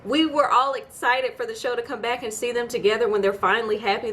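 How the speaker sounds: ordinary talk, nothing marked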